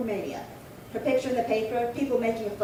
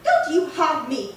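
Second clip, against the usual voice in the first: mocking laugh; 5 semitones higher, 7 dB louder, slower than usual. Sisters quarrel, [name] mocks Betsy.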